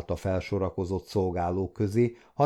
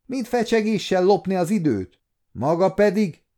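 higher, louder scolding; 9 semitones higher, 5 dB louder than usual. A narrator's reading. Cook scolding the girl and stranger, loud. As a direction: berate them both out loud. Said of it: "Fordult Lászlóhoz nagy hangon".

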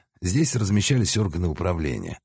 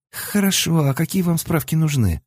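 put-on young woman voice; 11 semitones higher, 3 dB louder than usual. A narrator's reading. Calmer girl clerk.

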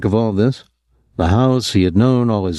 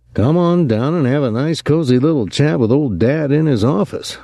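mocking, sing-song and higher; sarcastic; 6 semitones higher than usual. A narrator's reading. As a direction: sneer it as a fake friendly invite. Bitter sarcastic mock invitation.

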